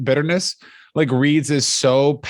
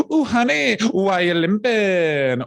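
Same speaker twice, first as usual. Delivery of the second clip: high, sassy, slow call; high teasing "Ooh, honey"; imitating a street worker; 5 semitones higher, slower than usual. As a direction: coo at a limping passerby. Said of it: "prostitutes kept saying things like".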